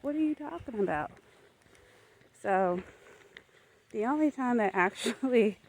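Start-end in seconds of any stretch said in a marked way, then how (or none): 0.0-1.1 dismissive. Retold or imitated. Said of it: "somebody will tell me".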